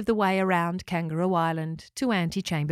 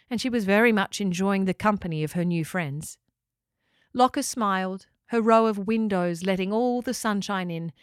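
usual speaking voice

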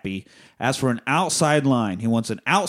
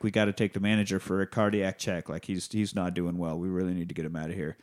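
low, soft, fast urgent murmur; a doctor's urgent aside, much softer; quiet doctor; 6 semitones lower, 7 dB quieter, faster than usual. Retold or imitated. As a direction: hush it out quickly and low.

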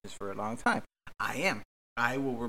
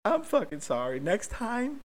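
much higher, far whiny rant; whiny little voice; 11 semitones higher than usual. Acted. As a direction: squeak it out like a wronged kid. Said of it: "I sound like a little bitch".

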